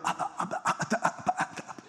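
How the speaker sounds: breathless, voiceless stalled "ah, ah"; mock stammering. Preacher mimics himself stuck at the podium, mock stammer. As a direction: act out being lost for words at the podium. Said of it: "I don't wanna get up there and go".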